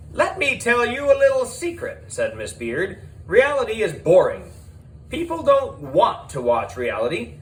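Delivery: high, brash showbiz producer voice. A narrator's reading. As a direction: sell it like a pushy TV producer. Miss Beard character voice.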